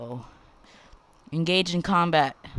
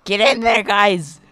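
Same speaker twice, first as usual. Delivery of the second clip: loud cheer; 3 semitones higher, 11 dB louder than usual. Real, not acted. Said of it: "cheering units on".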